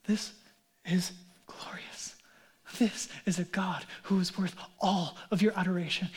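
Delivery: hushed, breathy whisper of wonder; awed whisper. Real, not acted. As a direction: drop to a reverent whisper. Awed whisper on God's glory.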